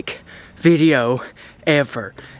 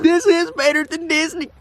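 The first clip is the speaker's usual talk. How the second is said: excited; 11 semitones higher than usual. Real, not acted. Overjoyed after long hunt.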